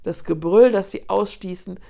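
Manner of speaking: ordinary delivery, nothing marked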